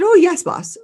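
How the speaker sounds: high, loud eager reply to the boss; eager employee. Retold or imitated. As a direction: chirp it out like a keen employee.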